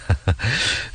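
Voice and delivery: short acted laugh before speaking; good-natured chuckle. A narrator's reading. Soldier answering his comrade's question, good-natured chuckle. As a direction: chuckle lightly, in character. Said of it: "기물애가 웃으며 말했다".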